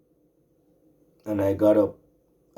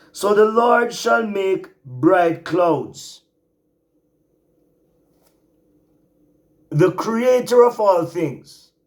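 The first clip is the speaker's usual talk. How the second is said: higher, louder preaching, drawing out key words; full-force preaching; 8 semitones higher, 11 dB louder, slower than usual. Acted. Preacher exhorting his remnant brothers and sisters, fiery and high. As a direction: preach it, lift the congregation.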